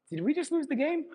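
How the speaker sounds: mock the mascot's shock; stunned disbelief.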